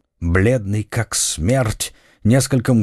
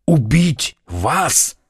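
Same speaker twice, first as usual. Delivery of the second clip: horrified; 4 semitones higher, much slower than usual. Acted. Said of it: "в ужасе вскрикнул Фельтон".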